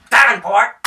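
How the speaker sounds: loud, high call to a servant; a lord calling his man in; shouted summons; summoning call. Acted. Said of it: "he's like".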